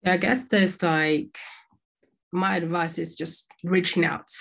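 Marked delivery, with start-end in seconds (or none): none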